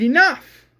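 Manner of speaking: screaming. Retold or imitated. Rick ending the quarrel with a high loud shout. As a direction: bark it to stop the fight. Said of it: "Rick screams".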